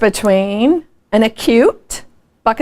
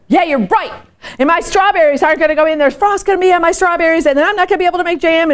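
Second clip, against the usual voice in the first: joining the whining; 7 semitones higher, faster than usual. Retold or imitated. Joining the complaining.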